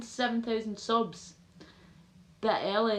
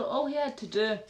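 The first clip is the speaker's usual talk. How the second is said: ordinary talk, nothing marked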